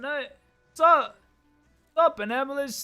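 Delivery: ordinary talk, nothing marked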